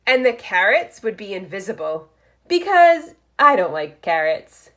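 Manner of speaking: lively picture-book read-aloud; reading to children. Acted. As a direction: read it like story time for first graders. Reads picture book aloud to class.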